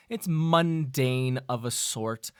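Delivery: ordinary talk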